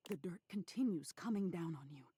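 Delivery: ordinary delivery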